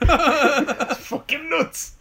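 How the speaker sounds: high, slow laugh through words. Real, not acted. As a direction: squeeze it out while cracking up. Laughing at a mad stunt.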